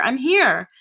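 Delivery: loud, high call. Acted. Narrator calling to her mother, loud call. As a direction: call it again at full voice. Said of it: "I said louder".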